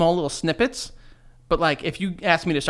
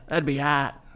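a light Boston accent; 3 semitones lower, 5 dB quieter, slower than usual. Shown as a demonstration. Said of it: "That was Boston".

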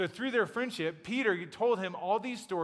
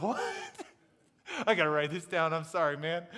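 baffled disbelief; 4 dB louder than usual. Retold or imitated. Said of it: "And Mark's like".

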